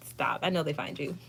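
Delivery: ordinary talk, nothing marked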